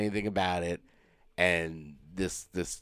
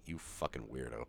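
very low, very soft aside; muttered imitated disgust; 8 semitones lower, 16 dB quieter than usual. Host voices the wife looking at husband, low mock mutter. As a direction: mutter it under your breath, imitating her.

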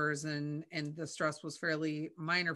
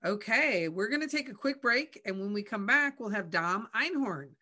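higher, louder radio-host lift; show host announcing; 5 semitones higher, 6 dB louder than usual. Acted. Host to listeners before the guest joins, bright announcer projection.

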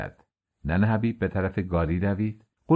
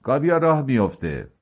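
raised, higher calling voice; shouting; 5 semitones higher than usual. A narrator's reading. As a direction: call out the departure across the yard. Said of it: "یوزباشی از میان کاروانسراها فریاد زد".

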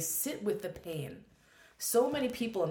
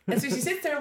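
normal talking voice

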